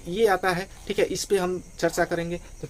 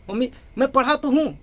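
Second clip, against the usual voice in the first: put-on higher, louder, irritated child's retort; annoyed child; 7 semitones higher, 5 dB louder than usual. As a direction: snap back at mum like a cross kid. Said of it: "बच्चा थोड़ा गुस्से में". (गुस्से में बच्चे की तरह झटके से, ऊँची आवाज़ में)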